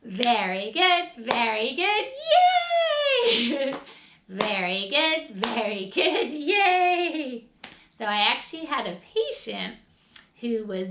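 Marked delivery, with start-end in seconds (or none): 0.0-3.7 slow, loud praise rising to a high squeal; excited yay; cheering. Shown as a demonstration. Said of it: "And let's say". 4.3-7.5 slow, loud praise rising to a high squeal; excited yay; cheering. Shown as a demonstration. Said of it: "And let's say".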